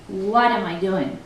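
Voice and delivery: normal talking voice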